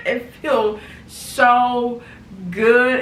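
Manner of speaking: laughing through slow, loud words; laughing with delight. Real, not acted. Trying on her sweater, laughing with delight. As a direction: let the laugh carry the words.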